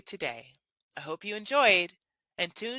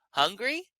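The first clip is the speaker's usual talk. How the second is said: "Hungry" ending higher than it starts; rising one-word question; 3 semitones higher than usual. Shown as a demonstration. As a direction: turn "Hungry" into a question by sliding the pitch up. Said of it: "make the end of the word higher pitched than the start".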